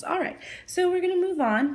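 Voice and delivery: usual speaking voice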